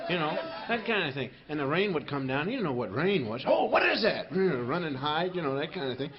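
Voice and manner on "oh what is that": startled caveman. Acted. Caveman sees rain first time.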